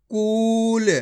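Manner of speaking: कूल with a long, held "oo"; drawn-out vowel. Shown as a demonstration. Teacher showing pupils how to read it, drawn-out कूल. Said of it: "खींच के कूल".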